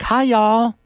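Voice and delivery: bubbly Southern pop-star greeting, pitched high. Acted. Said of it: "do you get all [name]".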